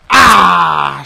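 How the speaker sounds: loud open-mouthed "ah"; startled yell. Real, not acted. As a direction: cry out as the hit lands. Cries out at game mishap.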